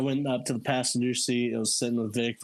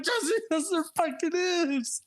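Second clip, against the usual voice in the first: very high mock exasperation; hammed-up frantic insistence; exasperated contradiction. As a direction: argue back with the voice shooting up. Retold frantic argument over puke.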